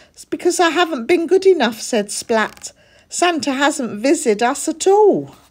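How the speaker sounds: high, fast anxious little cat voice; young cat fretting about Santa; upset kid cat. A narrator's reading. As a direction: blurt out the worry. Splat despairing.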